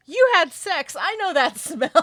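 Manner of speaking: loud, very high-pitched acted accusation; hammed-up, high accusing shout; mock accusation. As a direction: blurt it out like an outraged character, breaking into a laugh. Acted accusation from retold film scene.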